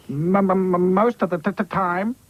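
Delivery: stammering m- m- m- and t- t-; stutter. Acted. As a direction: stutter through it.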